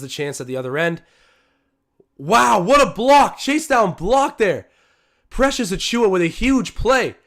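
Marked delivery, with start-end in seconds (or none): 2.2-7.2 high, loud, slow hype over the block; excited play call. Real, not acted. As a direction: shout it out like a big highlight. Calling a big block live.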